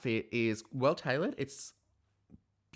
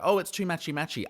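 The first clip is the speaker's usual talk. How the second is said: mocking the judges; 7 semitones higher, 4 dB louder, faster than usual. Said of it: "they're like".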